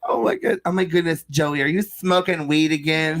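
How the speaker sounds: high, slowing mock mom scolding. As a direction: play a shocked mom scolding her son. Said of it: "voicing his mom in story".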